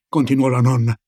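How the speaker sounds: usual speaking voice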